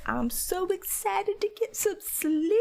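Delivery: high, slow sing-song mock glee; mock excited. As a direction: gush about sleep like a giddy kid. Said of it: "I was just like".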